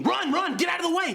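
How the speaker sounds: high, loud panicky mock scream; inner voice screaming. A student retelling his panicked inner voice to his teacher, high mock scream. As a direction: yell it like your own terror is shouting. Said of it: "a voice inside my head screaming".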